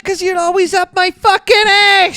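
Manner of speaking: mock wife shrieking in a fight; screaming wife. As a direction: scream back at your husband. Wife character screams back.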